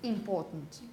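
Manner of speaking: "important" with a light short I first and the stress on the middle syllable; low, falling model "important", first syllable weak; unstressed first syllable. Shown as a demonstration. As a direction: skim the start of "important", lean on the second syllable. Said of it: "and it's unstressed".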